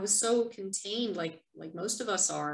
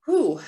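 relieved exhale; 6 semitones higher, 13 dB louder than usual. Real, not acted. Exhales after finishing the reading.

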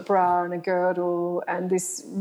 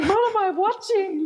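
jumps way higher in mock shock; stunned disbelief; 13 semitones higher than usual. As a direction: gasp it out like you can't believe your eyes. Shocked watching striptease.

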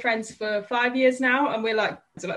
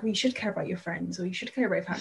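quiet, lower mocking lecture; mock preachy people; 4 semitones lower, 6 dB quieter, faster than usual. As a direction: sneer at their advice softly. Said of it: "they're like".

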